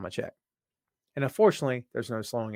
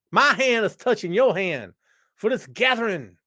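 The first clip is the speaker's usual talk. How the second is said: mock preacher; 7 semitones higher, 7 dB louder than usual. Mock solemn touching hands.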